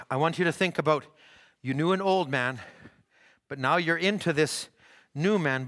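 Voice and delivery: ordinary talk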